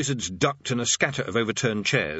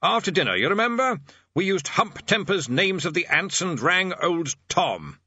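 higher, emphatic voice for poor hearing; raised voice; 8 semitones higher than usual. Acted. Bursar explains to the deaf old man, raised voice. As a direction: keep projecting at the old man's ear. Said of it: "directing his voice at the old man's ear".

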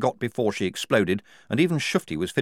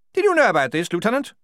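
high, loud officer's bark; 12 semitones higher, 6 dB louder, faster than usual. A narrator's reading. The major rounding on Blouse, a loud bark. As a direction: snap the question at Blouse. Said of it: "he barked".

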